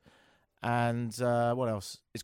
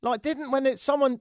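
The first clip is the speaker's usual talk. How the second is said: ordinary talk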